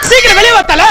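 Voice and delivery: loud, high alarmed call; urgent shout. Acted. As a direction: raise the alarm for the boss. Calling the boss out urgently. (தலைவனை அவசரமாக அழைக்கும் கூச்சல்)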